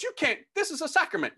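high-pitched appalled protest; upset priest. Retold or imitated. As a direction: imitate a scandalized priest objecting. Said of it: "he got upset".

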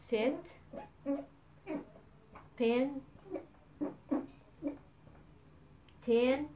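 sit, pin, tin with a short clipped i; short i demo. Shown as a demonstration. Teacher giving students short i examples, modelled clearly. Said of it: "مثلًا نقول".

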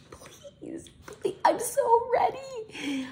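very high, drawn-out squeaky plea; pleading squeal. Real, not acted. Mother pleads for big family news, high pleading squeal. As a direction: squeal it, stretched out with longing.

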